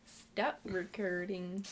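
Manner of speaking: slow forced Canadian accent; drawn-out, put-on Canadian accent. Shown as a demonstration. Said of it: "trying to force my Canadian accent".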